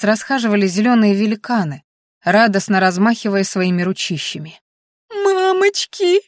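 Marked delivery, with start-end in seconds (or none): 5.2-6.2 high-pitched, drawn-out squeal; panicked squeal. A narrator's reading. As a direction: squeal for mummy in comic terror. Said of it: "верещала Анфиса".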